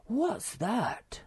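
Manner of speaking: high, alarmed question; sudden high-voiced fright; startled gasp. A narrator's reading. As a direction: gasp it out, pitched right up. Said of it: "gasped Moomin Troll".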